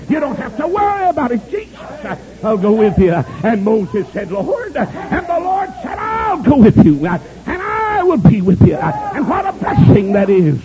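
high, stretched preacher's cadence; fervent preaching. Real, not acted. Preacher passing God's promise to Moses on, fervent preaching. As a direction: proclaim it high and slow.

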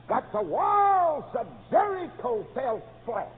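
slow, loud, high preaching peak; booming fell flat. Acted. Preacher to congregation, booming and slow. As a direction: drag it out and thunder it.

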